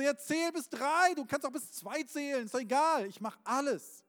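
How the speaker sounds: high, loud, urgent shout; impatient pleading. Acted. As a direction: call up frantically, willing to do anything. Climber impatient to be rescued. (ungeduldig und flehend rufen)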